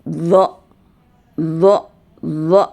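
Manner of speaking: zo buzzed with the tongue on the upper teeth; buzzing zo, tongue against the teeth; zo drill. Shown as a demonstration. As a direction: repeat zo with a buzzing z. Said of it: "Sambil kita berbunyi".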